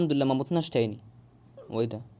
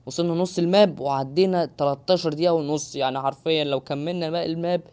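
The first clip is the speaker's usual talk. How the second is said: normal talking voice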